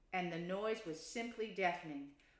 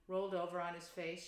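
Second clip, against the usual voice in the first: ordinary delivery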